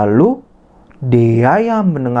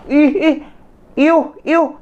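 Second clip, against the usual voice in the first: high, mock-disgusted ih iuh; disgusted squeal. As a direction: recoil as if she smells bad. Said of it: "Lalu Rini bilang". (jijik dengan nada tinggi)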